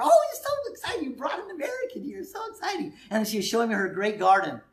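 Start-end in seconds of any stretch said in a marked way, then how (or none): 0.0-2.9 very high falsetto babushka voice; excited old lady. Retold or imitated. Babushka telling her daughter about the American, excited high falsetto. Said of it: "She's just telling her daughter".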